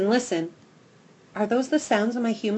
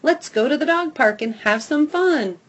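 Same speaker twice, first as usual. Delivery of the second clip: eager invitation; 5 semitones higher, 5 dB louder than usual. A narrator's reading. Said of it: "cocking my head in that cute way".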